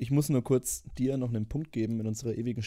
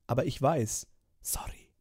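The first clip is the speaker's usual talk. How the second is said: gehauchtes, tonloses Flüstern; 4 dB quieter than usual. Acted. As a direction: ganz leise dazwischenflüstern. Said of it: "dieses Flüstern immer zwischen den". (geflüstertes Einwerfen)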